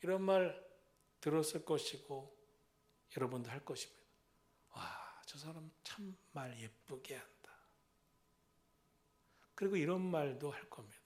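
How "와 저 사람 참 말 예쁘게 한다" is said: slow hushed admiration; admiring whisper. Retold or imitated. Listener whispering admiration of a kind speaker. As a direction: marvel quietly.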